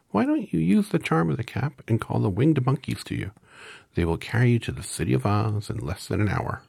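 very high, tiny squeak; squeaky mouse voice. A narrator's reading. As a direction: squeak like a little mouse queen. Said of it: "in her squeaky little voice".

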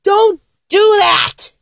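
high, stretched-out objection; exasperated protest. Acted. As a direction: burst out at the pleading look. Fends off the goo-goo-eyed look.

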